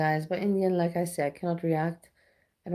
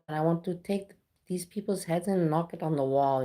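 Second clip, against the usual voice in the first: ordinary talk, nothing marked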